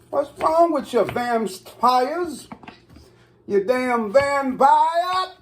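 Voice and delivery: high-pitched playful scolding; high, drawn-out mock outrage; mock indignation. Mock scolding of rare steak eaters.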